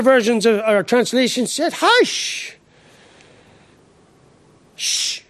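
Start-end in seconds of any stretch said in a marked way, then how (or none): 1.7-5.2 hushing with a touch of a scolding parent; hushing a child. Retold or imitated. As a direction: shush them like a naughty child. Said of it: "Like you'd say to your naughty child".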